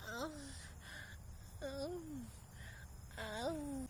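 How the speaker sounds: breathy high-pitched moans, softly; imitating a man moaning like a lady; mock high moans. Mocking her hookup's high-pitched moaning in bed. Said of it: "moaning in this high-pitched, like, noise".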